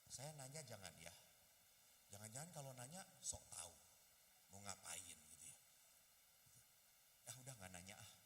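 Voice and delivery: low, soft, hesitant self-talk; introvert's inner debate. Acted. Introvert wondering whether to greet seatmate, low soft musing. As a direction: talk yourself out of asking, very low. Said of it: "kalau orang introvert ada yang duduk aja".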